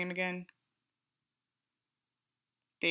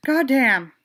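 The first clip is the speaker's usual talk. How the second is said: loud goddamn; 3 semitones higher, 7 dB louder than usual. Real, not acted. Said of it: "three takes of goddamn".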